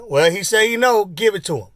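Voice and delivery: high, loud teasing biker; amused biker voice. Retold or imitated. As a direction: egg him on loudly. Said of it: "And he said".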